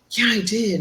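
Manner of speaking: high-pitched mock plaintive answer; acted woeful little reply; imitated troubled inmate. Inmate answering about imaginary baby.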